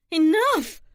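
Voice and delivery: high, loud, sudden outburst; snapped. A narrator's reading. Daughter stopping the two women's quarrel, snapped. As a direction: cut them off with a sharp cry. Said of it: "Brooke snapped".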